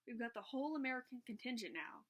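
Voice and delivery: usual speaking voice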